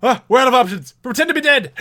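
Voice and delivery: high, loud dramatic order; mock urgent shout. Co-host acting out the army scene, mock urgent shout. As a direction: shout it high as a hammed-up desperate plan. Acting out panicked plan in film.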